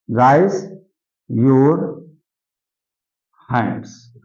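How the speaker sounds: slow, with a long pause after each word; word-by-word dictation. Shown as a demonstration. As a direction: dictate "raise ... your ... hands" for writing. (शब्द-दर-शब्द रुककर लिखवाते हुए)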